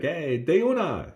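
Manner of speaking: high, drawn-out eager yes; excited agreement. Retold or imitated. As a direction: sound thrilled to go along. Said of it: "And he'd be like".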